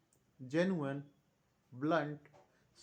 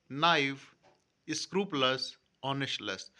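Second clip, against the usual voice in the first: loud, deliberate dictation, one word at a time; word list dictation; 5 dB louder, slower than usual. Acted. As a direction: call each word out clearly for the class to write down. (कक्षा को शब्द लिखवाते हुए, ज़ोर से और साफ़-साफ़)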